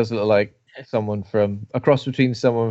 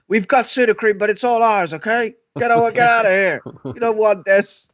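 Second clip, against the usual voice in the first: higher, shooing him off; 8 semitones higher than usual. Retold or imitated. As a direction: get rid of him, half joking. Campers shoo him away.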